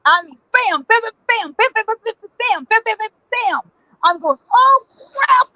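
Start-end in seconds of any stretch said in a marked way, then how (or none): 0.5-3.6 high rapid-fire bam noises; imitated gunfire. Runner hearing gunfire behind her, high imitated shots. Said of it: "I'm hearing gunfire". 4.5-5.5 high mock-panicked exclamation; alarmed cry. Running like hell from gunfire, high acted alarm.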